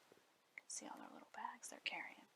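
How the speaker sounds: hushed storyteller whisper; whispered aside. A narrator's reading. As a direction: whisper it to the child as a secret. Narrator whispers aside to listener.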